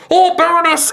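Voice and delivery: high, loud cry of recognition; greeting shopkeeper. Acted. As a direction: hail a noble customer in a high character voice. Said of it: "he sees you all ... and he's like".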